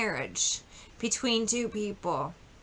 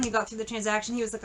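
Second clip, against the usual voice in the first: normal talking voice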